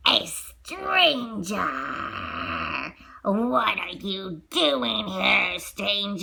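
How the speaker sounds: low, very slow menacing growl; beast voice. Acted. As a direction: loom over the intruder. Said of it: "the beast said to Maurice".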